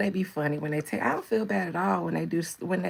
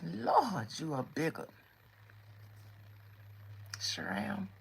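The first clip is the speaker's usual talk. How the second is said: mock old lady; 2 semitones lower, 8 dB quieter, slower than usual. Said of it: "I'ma let y'all young girls have it".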